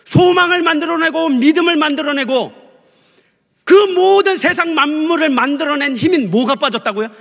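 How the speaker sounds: high, loud, building sermon climax; full-force preaching. Real, not acted. Preacher building to a question for the congregation, high forceful proclamation. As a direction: proclaim each phrase, pitched high.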